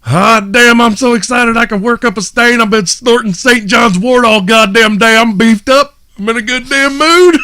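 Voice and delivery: high, loud, wired rant; hyped comedian. Retold or imitated. The comedian greets the crowd, wired, high and loud. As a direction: bounce off the walls.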